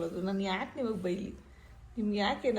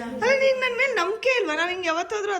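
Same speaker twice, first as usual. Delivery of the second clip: much higher, louder hurt question; exasperated plea; 9 semitones higher, 7 dB louder than usual. Real, not acted. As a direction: protest that she should trust you. Pleading with crying sister.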